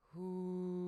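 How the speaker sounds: rounded hoo tone through pursed lips; shaped hoo. Shown as a demonstration. Teacher demonstrating a shaped hoo tone. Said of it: "shape the sound like a".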